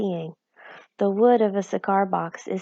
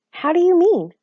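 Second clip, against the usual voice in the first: a startled question, high and loud; 10 semitones higher, 7 dB louder than usual. A narrator's reading. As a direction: jump at the news. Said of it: "asked Homily, startled".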